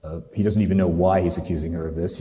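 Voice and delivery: usual speaking voice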